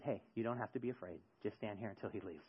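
very soft and low, retold comfort; calm her fear in a hushed low voice; gentle reassurance. Man telling a scared barista she is safe, hushed and reassuring.

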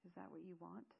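soft, hurt question. Acted. Zale, hurt, asking her wish, speaking softly. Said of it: "He spoke softly to me".

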